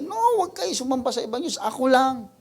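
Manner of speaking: high, fast commanding voice of God; God's voice. Acted. Preacher voicing God, forbidding other gods, high and fast. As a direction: speak as God, pushing them away from idols.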